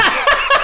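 a quick unvoiced burst of laughter; real laugh, all breath; breathy laugh. Real, not acted. Laughs at honeymoon story.

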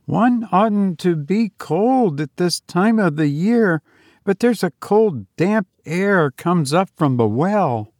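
put-on high girl's voice, a little uneasy; chilly young woman. Voices Olive complaining of the cold.

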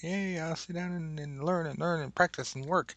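put-on high, thrilled kid squeal; eager child. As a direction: gush like a boy who finally got his teacher. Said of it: "I'm like".

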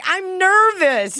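high, drawn-out flustered excuse; mock nervous whine. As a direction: plead it high and slow, hammed up. Acted driver excusing accidental farting.

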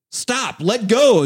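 high, drawn-out teacher's command; slow, high-pitched mock yell; retold scolding shout. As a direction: re-enact yelling at an unruly kid. Substitute yelling at kid in chokehold.

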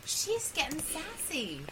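high mock-indignant voice; playfully offended; mock outrage. Real, not acted.

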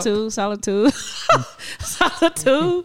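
drawn-out, sing-song Solitude; exaggerated cartoon intonation. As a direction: stretch Solitude slowly, hamming it. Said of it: "Why are you talking like that?".

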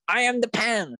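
high, emphatic imitation of Jacob; hammed-up film quote. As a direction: declaim it high, mocking the line. Quotes Jacob's weird film line.

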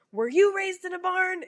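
high, mocking scolding voice; angry man voice. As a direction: mimic a snippy man scolding. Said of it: "He's like".

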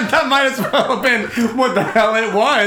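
high cackling laughter; laughing. Real, not acted. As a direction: laugh hard, pitch soaring. Group cracks up at joke.

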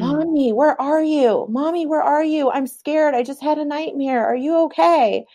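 put-on high, scared little boy voice; a small boy crying for Mommy; child calling out. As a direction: call out like a frightened child. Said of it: "yelled out for me".

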